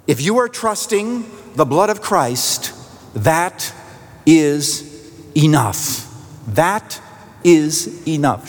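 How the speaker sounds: THAT, IS and ENOUGH each set apart by pauses; emphatic assurance. Retold or imitated. Pastor assures a doubting woman, firm word-by-word stress on "that is enough".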